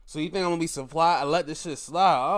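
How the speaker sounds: usual speaking voice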